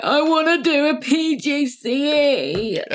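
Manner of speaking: retold teary wail; crying. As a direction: sob it out to your mum. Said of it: "I called my mum and I was like".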